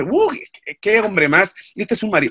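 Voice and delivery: high-pitched, scornful gossip; mock disdain. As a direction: voice an onlooker judging a crying man. Acted judgement of crying man.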